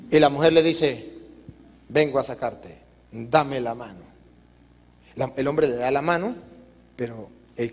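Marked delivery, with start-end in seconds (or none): 1.9-4.0 low, soft rescuer's voice; calm reassurance. Acted. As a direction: gently offer a hand to someone lost. Old woman offering rescue, soft voice. (voz baja y serena, tranquilizadora)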